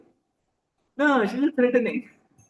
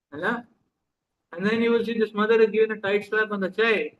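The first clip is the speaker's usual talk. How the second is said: ordinary talk, nothing marked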